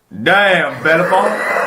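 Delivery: high, slow, drawn-out Pegasus with laughs; slow cocky winged horse, laughing. Pretend. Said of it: "Can you do, uh, Pegasus voice again?".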